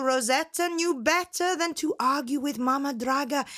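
usual speaking voice

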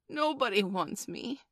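put-on little boy on the edge of tears; nearly crying. A narrator's reading. Rejected small boy to his mother, nearly crying. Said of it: "Michael had nearly cried".

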